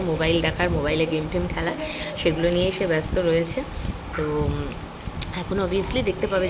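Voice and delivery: ordinary talk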